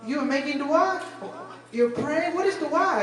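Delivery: mock high-voiced nosy passenger; high puzzled lady. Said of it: "She goes".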